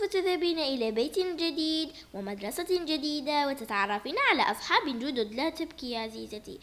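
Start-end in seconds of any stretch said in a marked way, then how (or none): 5.3-6.6 soft consoling. Acted. Father consoling daughter.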